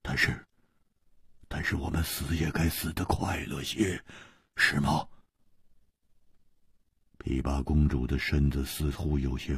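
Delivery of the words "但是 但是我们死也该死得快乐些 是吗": soft, low, slightly hoarse plea; hushed, husky suggestion. A narrator's reading. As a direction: coax quietly with a touch of hoarseness. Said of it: "嘶声地说".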